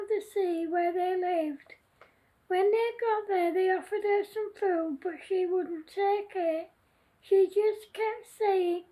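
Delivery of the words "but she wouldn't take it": plain reading voice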